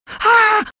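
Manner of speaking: high, loud startled yelp; startled cry. Acted. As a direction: jump with fright. Scared by shadow.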